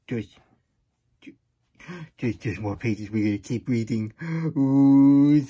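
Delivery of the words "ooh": loud gasp. Pretend. Dramatic reaction to more pages.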